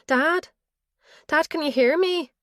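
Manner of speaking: high, loud frightened calling; panicked calling. A narrator's reading. Daughter calling her collapsing father, high and panicked. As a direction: cry out to him in alarm.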